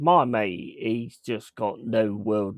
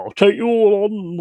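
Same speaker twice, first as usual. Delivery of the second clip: mock tough guy; 8 semitones higher, 7 dB louder than usual. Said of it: "like, go".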